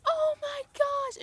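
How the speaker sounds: high, drawn-out excited squeal. Retold or imitated. Mom greeting a fan at the show, excited squeal. Acting out mom's delighted greeting.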